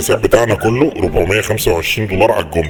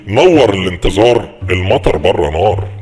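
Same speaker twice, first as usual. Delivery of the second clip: slow navigation prompt delivery; announcement voice; 4 dB louder, slower than usual. Shown as a demonstration. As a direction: read it like a GPS announcement. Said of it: "تقول لهم إيه؟". (صوت إعلان ملاحة بطيء)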